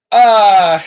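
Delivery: a loud fed-up "uh" that breaks into a breathy laugh; exasperated yell then laugh. Real, not acted. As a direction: blurt out in disbelief, then laugh it off. Exasperated outburst at Lego decision.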